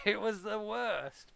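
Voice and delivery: laughing disgust. Real, not acted. Disgust at club memory.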